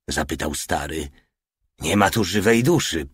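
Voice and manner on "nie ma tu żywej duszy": ordinary delivery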